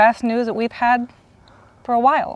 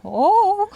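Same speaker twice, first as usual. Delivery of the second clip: high squeaky imitation of a cartoon "Oh"; imitating the Popeye sound; 11 semitones higher, 4 dB louder than usual. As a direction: toss off the cartoon's little high "Oh" as a fond homage. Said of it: "you were doing the, the sound earlier".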